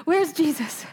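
a hint of frantic searching; mock panic. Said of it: "I just picture them in a panic".

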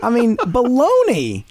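loud slow scoffing baloney; emphatic baloney. Real, not acted. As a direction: scoff hard at the idea, stretching baloney. Dismissing the idea of relaxing.

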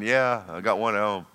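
low, soft mumbled aside with a laugh; congregant muttering aside. Retold or imitated. Church member joking about a relative at home, low chuckling mutter. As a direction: grumble it like a husband thinking of his wife. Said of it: "some of y'all are thinking".

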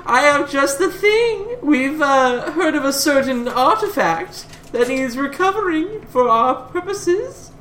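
high-pitched, drawn-out character voice; put-on high voice, addressing his darlings; Hugo. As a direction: pipe up high and stretch out the phrases. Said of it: "Hugo immediately pipes up and says".

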